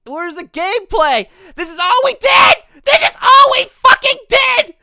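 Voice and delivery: real outraged rant; furious shout. Real, not acted. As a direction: yell it at the screen, voice cracking. Raging at a short demo.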